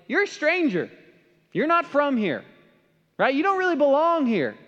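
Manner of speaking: high, emphatic voice of locals; imitated rejection. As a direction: voice townspeople telling an outsider off, pitched high. Voices locals treating him as outsider.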